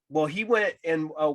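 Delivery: ordinary delivery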